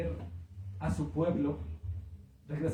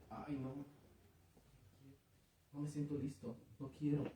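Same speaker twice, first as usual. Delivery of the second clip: mock reluctant whine; 5 semitones lower, 18 dB quieter than usual. Mocking a reluctant believer.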